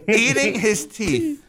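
slow, high, laughing repeat. Real, not acted. Host relishing the caller's punchline, laughing. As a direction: savor it slowly through real laughter. Reacting to funny caller clip.

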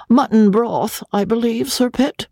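high, meek wife's voice; a timid wife answering up high; timid Lady Crawley. A narrator's reading. Wife answering her husband about dinner, high and meek. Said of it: "answered Lady Crawley".

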